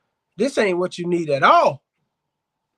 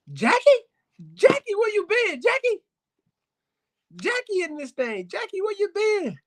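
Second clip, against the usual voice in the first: much higher, sing-song talk to the dog; 11 semitones higher than usual. Retold or imitated. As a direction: coo at the dog like a pet owner. Voicing a fond talk to dog.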